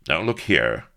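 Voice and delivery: loud, firm protest; indignant cry. A narrator's reading. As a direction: cut in sharply to object. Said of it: "cried Avery".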